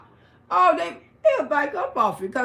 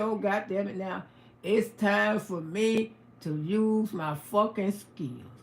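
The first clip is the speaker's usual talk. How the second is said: low menacing mutter; 7 semitones lower, 6 dB quieter than usual. Retold or imitated. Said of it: "I said".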